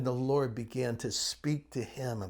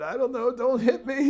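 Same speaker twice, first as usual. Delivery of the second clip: higher and louder mock plea not to be hit; mock scared; 10 semitones higher, 6 dB louder than usual. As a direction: playfully beg the old lady for mercy. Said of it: "I said".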